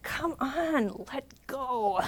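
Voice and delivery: a playful hint of an exasperated teacher urging a student; mock-stern urging. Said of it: "being the really stern teacher".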